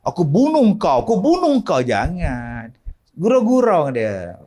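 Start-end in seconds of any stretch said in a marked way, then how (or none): 0.0-1.8 high, shouted threat; mock yelling threat. Healer yelling threats at a spirit, mocking. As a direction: mimic a healer snapping at a spirit. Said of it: "janganlah tengking-tengking".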